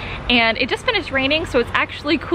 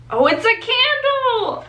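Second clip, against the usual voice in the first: delighted discovery; 11 semitones higher than usual. Real, not acted. Unboxing a surprise package.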